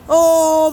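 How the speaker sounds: loud startled gasp. Real, not acted. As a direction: gasp at the cold. Hit by cold rain.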